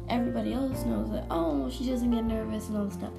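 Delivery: ordinary delivery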